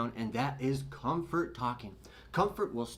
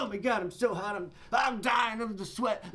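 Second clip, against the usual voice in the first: hammed-up whine about the heat, pitched higher; mock overheated complaint; 7 semitones higher than usual. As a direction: act out waking up way too hot. Acting waking up overheated.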